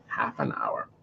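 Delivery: softly whispered echo of "half an hour". Real, not acted. Repeats phrase in a whisper.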